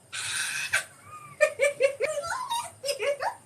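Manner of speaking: very high squealing giggle; giddy squeal. Real, not acted. Host watching her favorite part, giddy squealing laugh. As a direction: squeal with delight, long and high.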